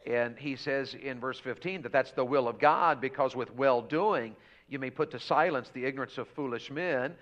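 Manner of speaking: ordinary talk, nothing marked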